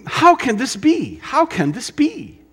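high, incredulous mock wonder; quoting a baffled mission trip visitor, high amazed tone; imitating amazement. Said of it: "I've actually said these things too".

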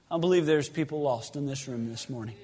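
ordinary talk

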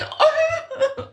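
a high giggle after her own joke; laughing. Real, not acted. Girl laughing at her own cheeky jibe. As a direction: laugh it off, pleased with herself.

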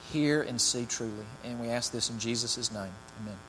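soft, earnest congregational prayer; a pastor praying before the sermon. Real, not acted. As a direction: pray quietly for the church.

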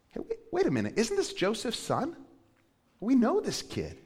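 a hint of small-town suspicion; doubting townsfolk. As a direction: voice the crowd turning skeptical.

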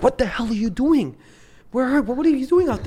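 high, startled scolding dad; alarmed dad. Retold or imitated. Dad finding his son outside, high alarmed scolding. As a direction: imitate dad catching him. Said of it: "It's like".

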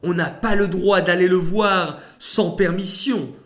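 high, loud frightened pleading; trembling fear. A narrator's reading. Esther afraid to approach the king. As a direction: stammer out her fear. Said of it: "elle se mit à trembler".

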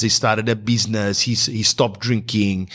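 ordinary delivery

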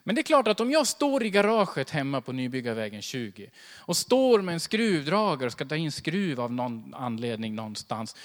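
ordinary talk, nothing marked

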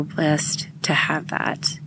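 softly whispered thanks; grateful whisper. Real, not acted. Mom thanking her partner's family, hushed whisper. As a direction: drop to a whisper of gratitude.

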